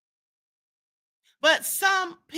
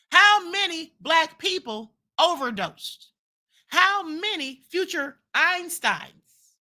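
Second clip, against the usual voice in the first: slow rhetorical questions; 3 semitones higher, slower than usual. Real, not acted. Preaching rhetorical questions to audience.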